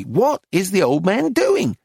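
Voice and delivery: high, emphatic exasperation; furious questioning. A narrator's reading. Dealer drags the story out of the painter, furious. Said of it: "she said furiously".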